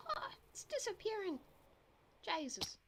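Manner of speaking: startled outcry. Real, not acted. Game world vanishing suddenly.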